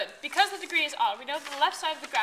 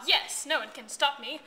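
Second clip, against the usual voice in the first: usual speaking voice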